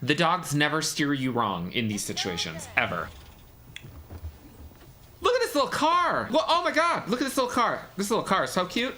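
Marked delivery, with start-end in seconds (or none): 5.2-8.9 high, excited squeal over something cute; gushing. Real, not acted. Viewer coos over a little car in the film, gushing. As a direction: gush in a high voice, easing down gradually.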